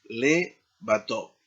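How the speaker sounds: "levatov" drawn out slowly; careful, slow "levatov"; slow demo. Shown as a demonstration. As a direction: model "levatov" slowly for students. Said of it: "para el plural será".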